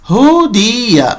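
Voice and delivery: slow ہو دیا, syllables apart; slow syllable drill. Shown as a demonstration. Teacher spells the word slowly for students. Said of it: "دیکھیے ہو پیش کے سبق کا ہے".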